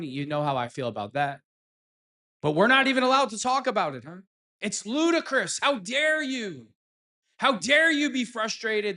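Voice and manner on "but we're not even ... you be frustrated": sarcastic, higher pitched mock indignation; sneering mock disbelief, higher; mock outrage. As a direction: parody the media's scolding tone.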